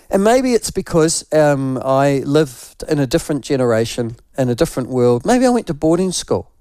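ordinary talk

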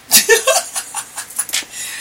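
high airy giggle; breathy laugh. Real, not acted. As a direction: laugh under your breath at yourself. Laughing at her own story.

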